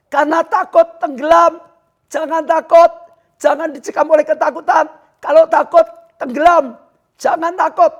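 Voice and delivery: loud, high-pitched sermon delivery; full-force preaching. Real, not acted. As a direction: preach at the top of your voice. (khotbah berapi-api)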